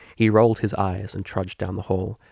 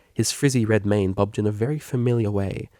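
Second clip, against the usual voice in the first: ordinary delivery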